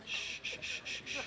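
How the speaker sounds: soft unvoiced shh; shushing. Real, not acted. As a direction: hush the room. Quieting a crowd of students.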